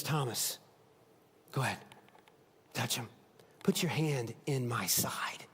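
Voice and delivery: low, soft whisper to Thomas; hushed, intimate whisper; whispered invitation. Acted. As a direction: draw him close, barely voiced. Jesus invites doubting Thomas.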